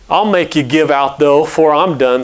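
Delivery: plain reading voice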